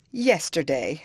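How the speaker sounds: plain reading voice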